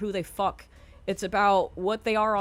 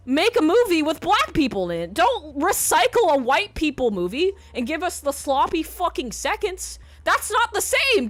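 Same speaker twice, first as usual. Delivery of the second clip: loud, higher, heated ranting; angry rant; 8 semitones higher, 7 dB louder than usual. Real, not acted. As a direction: lay into the studios, voice raised. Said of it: "it makes me mad".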